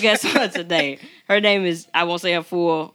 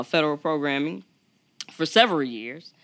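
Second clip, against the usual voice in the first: ordinary delivery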